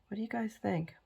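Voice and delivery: conspiratorial whisper to the viewers; stage whisper. Pretend. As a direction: lean in and whisper the question. Confiding aside to viewers.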